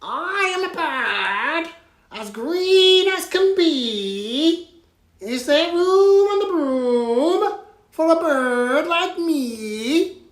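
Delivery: green bird voice. Acted. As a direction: squawk it like an eager little bird. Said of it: "then said".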